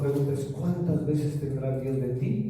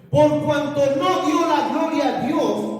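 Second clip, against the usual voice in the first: high, loud, slow declaration; forceful scripture reading; 11 semitones higher, 6 dB louder, slower than usual. Real, not acted. Preacher reading God's judgment on Herod, forceful proclamation. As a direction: proclaim the verdict from the pulpit.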